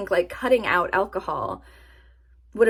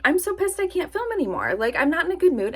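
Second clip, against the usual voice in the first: annoyed rant; 8 semitones higher than usual. Retold or imitated. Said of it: "And then I was like".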